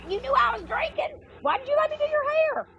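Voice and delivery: very high incredulous whine; high, mock-outraged whine at her mom; mock exasperation. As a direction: squeal it way up in disbelief. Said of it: "But I'm like".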